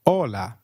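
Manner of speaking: "Hola" beginning straight on the O, H unspoken; silent H. Shown as a demonstration. Teacher repeats "Hola" for the learner, silent H. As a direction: drop the H completely in "Hola". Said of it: "H is always silent in Spanish".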